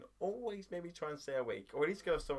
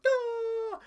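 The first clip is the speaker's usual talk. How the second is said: very high, loud "da" howl; exaggerated drawn-out yelp; mock wail; 9 dB louder than usual. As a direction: echo it in comic falsetto. Echoes the wail back.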